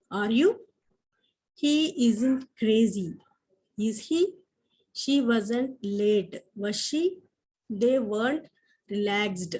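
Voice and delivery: slow, careful dictation of examples. Shown as a demonstration. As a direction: spell out each example sentence for the class. Teacher dictates example sentences. (నెమ్మదిగా dictation చేస్తూ)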